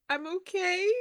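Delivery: tearful, high cracked voice; tearful, voice cracking high. Acted. Galena insisting she's okay while starting to cry, tearful and high. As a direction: squeeze it out high through tears. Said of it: "her eyes start tearing up".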